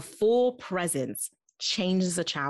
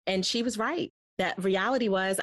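usual speaking voice

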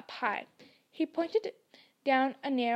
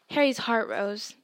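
ordinary delivery

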